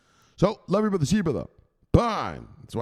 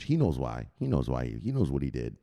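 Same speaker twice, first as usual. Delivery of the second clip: lower, quieter knowing menace; ominous low tone; 10 semitones lower, 4 dB quieter than usual. Real, not acted. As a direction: say it darkly like a grudge. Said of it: "Why do you keep calling him".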